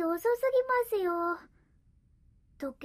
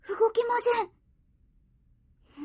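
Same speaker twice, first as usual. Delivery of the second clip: alarmed; 7 semitones higher, 6 dB louder than usual. Acted. Master lies motionless on bed.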